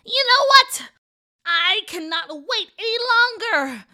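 loud, high outburst of fury; furious and frustrated. A narrator's reading. Old woman done waiting on captive boy, furious. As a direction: explode with fed-up impatience. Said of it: "grow furious and frustrated".

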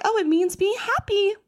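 high, sing-song grown-up explaining; mock sweet adult. Adult fobbing off a curious kid, high and sing-song. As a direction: talk down gently like a cheery adult.

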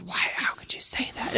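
hushed, scandalized whisper; voiceless imitation of shock; mock horrified whisper. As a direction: whisper it as if appalled. Acting out horrified parents' reaction.

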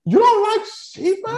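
slow high mock-shocked question; incredulous quote. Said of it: "And I was like".

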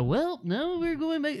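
very high, soft-edged character voice; lilting, high-pitched cartoonish impression; Korg impression. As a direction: imitate a comic sidekick way up high. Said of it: "You do a really good interpretation of Korg".

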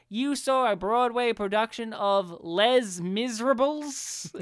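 Southern prosecutor slowly sounding out French; thick Southern accent. Acted. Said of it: "he's got a six, thick Southern accent".

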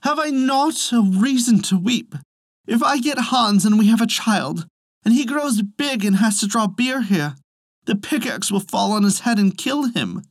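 lightly voiced fretting Elsie; mild weepy lament. A narrator's reading. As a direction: plead your reason to weep. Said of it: "said Elsie".

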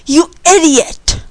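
angry holler. A narrator's reading. The man to Tom who stays silent, angry holler. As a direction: holler it slowly, drawing out the insult. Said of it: "the man hollered".

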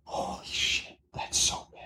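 stunned hushed whisper. Real, not acted. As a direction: whisper it, appalled. Reacting to a terrible stat.

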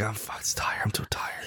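imitating exhaustion in a faint, voiceless whisper; worn-out whisper. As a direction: whisper it like a couple too tired to bother. Acting out a worn-out couple.

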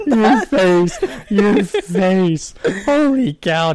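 loud laughing exclamation. Real, not acted. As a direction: crack up at his face. Laughing at friend's reaction.

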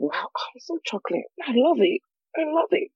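gushing self-love. Pretend. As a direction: build to a squealing rapture. Gushing over her own skin.

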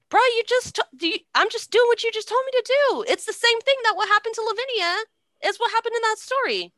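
high, fast, exasperated explaining; mock-innocent Titus. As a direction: rattle it off like it's painfully obvious.

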